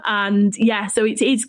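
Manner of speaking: ordinary talk, nothing marked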